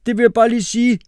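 put-on very high, loud boy's voice; squealed, indignant protest. A narrator's reading. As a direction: object at the top of your pitch. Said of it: "protesterede Mohammed".

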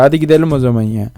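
usual speaking voice

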